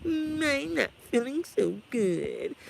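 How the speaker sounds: slow, mournful cartoon dog voice; hammed-up cartoon misery; sad cartoon dog. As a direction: drag it out mopey, like a droopy dog. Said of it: "when he be like".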